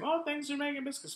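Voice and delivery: high, sing-song "thanks for making biscuits"; mock the thank-you he expected; imitating the wife's missing thanks in a high voice; mocking quote. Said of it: "instead of saying".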